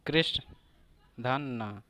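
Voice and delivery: ordinary delivery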